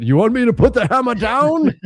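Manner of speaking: high, hammed-up superhero quote; Thor. Joking about his daughter's loud movie, put-on hero voice. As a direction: play a mock-incredulous movie hero. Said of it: "my daughter is watching Thor right now".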